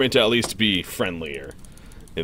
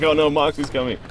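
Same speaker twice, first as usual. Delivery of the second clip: higher-pitched replay of own worry; mock alarm; 7 semitones higher, 3 dB louder than usual. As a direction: lightly imitate a moment of panic. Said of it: "I was like".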